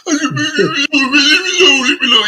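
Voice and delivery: high wailing leave me alone, imitating a crying kid; childlike crying plea, retold; mock crying plea. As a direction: whine it like a beaten boy. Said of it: "I'm crying".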